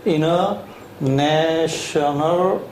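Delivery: "international." drawn out syllable by syllable, very slowly; very slow model word. Shown as a demonstration. Teacher slowly models "international." syllable by syllable for the class. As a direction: stretch every syllable of "international." out for dictation. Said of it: "이렇게 갑니다".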